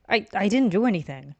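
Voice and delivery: startled, loud and defensive; flustered denial. Acted. Glared at, protests innocence.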